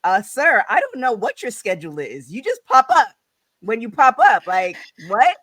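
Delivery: mock-outraged and loud; indignant comeback. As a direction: call him out in loud, incredulous disbelief. Indignant comeback to mayor's retold excuse.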